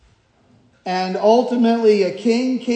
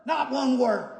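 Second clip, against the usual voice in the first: softer echo of "Not one word"; hushed repeat; 6 dB quieter than usual. Shown as a demonstration. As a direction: drop the volume and let it sink in. Preacher repeats the phrase softly.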